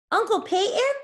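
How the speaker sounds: light up at the sudden sight; surprise. A narrator's reading. Charlie spotting his uncle in the rain, surprised high call. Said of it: "he said in surprise".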